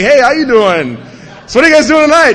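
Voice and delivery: loud, high, hail-fellow call; overeager greeting. Retold or imitated. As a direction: greet the guys far too heartily. Sidling up to supposed dealers.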